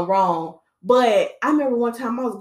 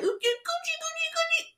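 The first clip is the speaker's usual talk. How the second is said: very high, soft coochie-coo babble; mock baby talk; 7 dB quieter than usual. Vlogger mocking how people talk to babies, squeaky baby talk. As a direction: imitate silly cooing at an infant. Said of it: "I don't believe in all that baby talk".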